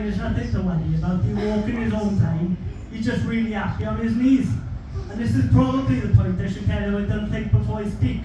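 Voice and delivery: ordinary delivery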